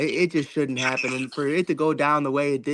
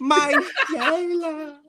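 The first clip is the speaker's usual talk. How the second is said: slow, high, half-laughing mock cry; mock wail; 12 semitones higher, much slower than usual. Real, not acted. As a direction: wail the name like a grieving fan. Mourning Kyrie's injury.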